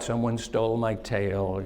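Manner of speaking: low, slow, gloomy donkey voice; mopey and defeated, sinking low; Eeyore. Acted. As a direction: drag it out low and soft, full of gloom. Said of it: "kind of like Eeyore".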